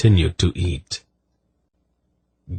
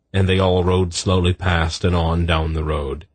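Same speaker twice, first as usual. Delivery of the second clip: plain reading voice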